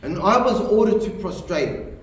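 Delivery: ordinary delivery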